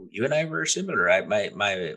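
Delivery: normal talking voice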